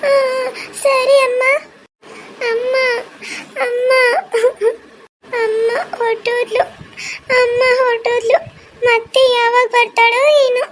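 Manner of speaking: put-on high child voice, sobbing between phrases; crying child. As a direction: act a little girl weeping for departed mother. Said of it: "ಮತ್ತೆ ಅಳ್ತಿದ್ಯಾ?". (ಅಮ್ಮನಿಗಾಗಿ ಅಳುವ ಪುಟ್ಟ ಮಗಳ ಧ್ವನಿ)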